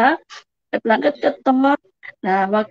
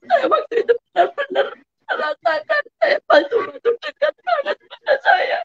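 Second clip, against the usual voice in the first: real tears, much higher-pitched voice; menangis haru; 6 semitones higher than usual. Real, not acted. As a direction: struggle on through crying, pitch climbing. Moved to tears by her testimony. (suara tinggi menahan tangis)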